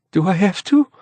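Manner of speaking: high, anxious plea; trembling. A narrator's reading. Frightened woman asking whether she must go in, trembling. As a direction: ask it pitched high, as if trembling.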